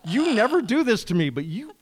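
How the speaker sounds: high, loud, laughing protest; laughing exasperation. Real, not acted. Host laughing at her cohost for derailing her. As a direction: laugh it out, thrown off track.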